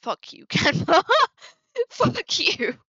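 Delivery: loud, high, cracking up mid-insult; laughing curse. Real, not acted. Streamer cursing the design software over blur, laughing in exasperation. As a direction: swear at it through real laughter.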